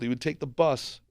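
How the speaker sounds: usual speaking voice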